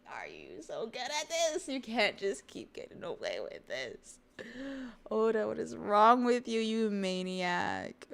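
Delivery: high, loud wail at the author; exasperated mock rant. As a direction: plead and yell in hammed-up outrage.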